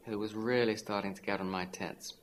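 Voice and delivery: plain reading voice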